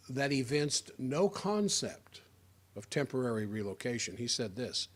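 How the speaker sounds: ordinary delivery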